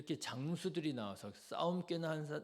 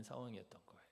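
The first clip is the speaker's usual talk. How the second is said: whisper; 3 semitones lower, 14 dB quieter, much faster than usual. Real, not acted. Hushed end of tense scene.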